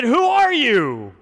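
high, loud, slow "who are you"; a startled shout into the darkness; startled call. Acted. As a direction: call out into the dark, alarmed. Boaz asks in the dark.

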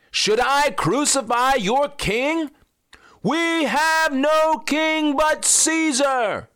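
very high, slow declaiming; slow, very high declaration; dramatic exchange. Acted. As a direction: declaim it slowly like the priests. Pilate and priests exchange.

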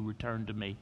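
soft, low and tender; hushed God's voice. Acted. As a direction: speak it gently like a quiet promise. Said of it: "hear God saying to you".

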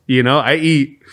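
usual speaking voice

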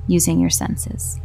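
calm, unhurried meditation voice with long pauses; guided meditation. Acted. Host guiding stressed marketers through mindfulness, calm and unhurried. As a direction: guide gently, leaving space after each instruction.

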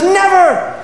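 put-on high, loud child's defiant cry; little girl's cry. Five-year-old girl answers Churchill, high and fierce. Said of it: "precious little five-year-old stands up and says".

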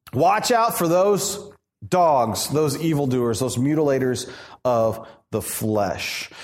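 slow, measured reading of scripture. Acted. Pastor reading the verse to the congregation, measured. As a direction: read the verse with weight.

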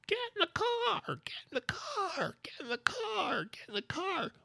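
very high, thin falsetto scream; hammed-up, high-pitched frantic yelling; mock scream. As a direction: scream it in falsetto without full volume. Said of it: "I'm screaming at the top of my lungs".

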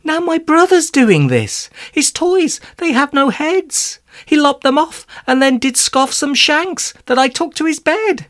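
high-pitched, agitated complaint; fretful. Acted. Arthur tells Romalie about his brother's toys, fretful and high. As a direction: act out an alarmed older brother.

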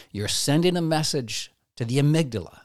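normal talking voice

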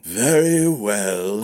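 put-on slow, grudging old sword master; grumbling. A narrator's reading. Sword master reluctantly agreeing, slow grumble. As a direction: grumble your reluctant consent. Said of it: "grumbled the sword master".

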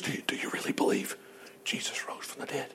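hushed doubting whisper; mock whisper of a churchgoer's doubt.

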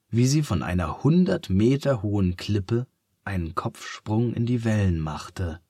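ordinary delivery, nothing marked

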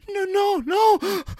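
high, loud terrified no; frightened high cry. Acted. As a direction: wail it like a scared boy. Boy crying out in fear.

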